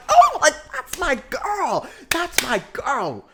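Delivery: proud fan gush. Retold or imitated. Fan cheering Angela's win, high slow proud gush. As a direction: coo it like a proud stan. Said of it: "I'm like".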